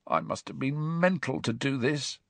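soft nervous croak; croaky whisper. Acted. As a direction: croak it softly, full of dread. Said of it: "he said in a croaky whisper".

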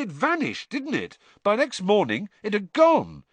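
high-pitched, exasperated impatience; impatient. A narrator's reading. Fred explains the leprechaun gold vanished, impatiently. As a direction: spell out the obvious impatiently. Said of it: "said Fred impatiently".

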